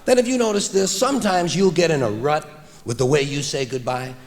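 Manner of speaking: ordinary talk, nothing marked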